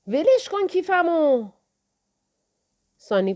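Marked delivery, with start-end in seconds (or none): 0.1-1.5 high, slow, strained cry; a boy begging them to leave his wallet; desperate protest. Acted. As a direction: stretch it out, pleading to stop. Boy protests as bag taken.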